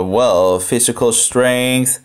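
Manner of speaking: usual speaking voice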